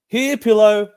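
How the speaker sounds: high, loud laughing joke line; hysterical laugh. Acted. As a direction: crack up at your own pun. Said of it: "laughing hysterically".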